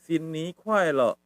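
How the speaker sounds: slow, drawn-out greeting demo; each syllable stretched out; pronunciation model. Shown as a demonstration. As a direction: say it slowly for learners to copy. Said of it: "Begitu cara ngomongnya ya".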